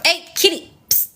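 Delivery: high sharp call to a pet; calling the cat. Real, not acted. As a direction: call out to the kitty.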